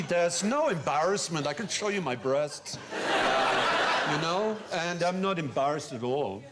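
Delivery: earnest mock-German accent; lilting, self-satisfied German accent. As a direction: play an open-hearted soldier with a comic German accent. Playing a modern German soldier.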